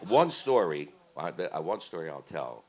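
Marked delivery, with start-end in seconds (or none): none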